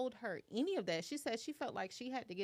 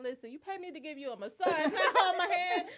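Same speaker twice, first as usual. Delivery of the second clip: laughing retort; 6 semitones higher, 7 dB louder than usual. Retold or imitated. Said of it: "I said".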